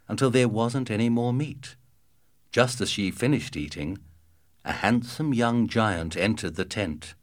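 plain reading voice

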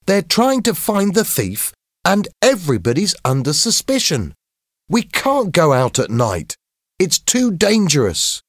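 high-pitched, urgent protest; worried schoolboy. A narrator's reading. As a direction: argue anxiously as a cautious boy, pitched right up. James argues with Billy.

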